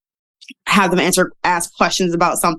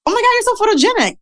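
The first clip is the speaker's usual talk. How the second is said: much higher, gushing mock fan voice; gushing compliment; faster than usual. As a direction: gush over her like an excited fan. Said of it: "And people were like".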